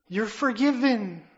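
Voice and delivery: forgiven proclaimed out loud and high; joyful preached proclamation; loud declaration. Real, not acted. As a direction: ring out the pardon. Jesus forgiving the listener.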